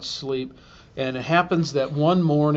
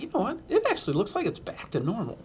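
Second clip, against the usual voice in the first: hopeful inner voice; 7 semitones higher, 6 dB quieter, faster than usual. Retold or imitated. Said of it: "I was like".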